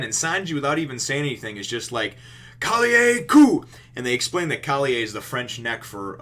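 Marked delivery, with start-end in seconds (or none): 2.6-3.6 high, loud, drawn-out battle call; mock attack cry. Sanji attacking the sea beast, mock battle cry. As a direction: stretch out the move's name in a shout.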